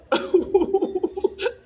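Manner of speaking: high, loud laugh bursting out; real laughter on spotting her. Real, not acted.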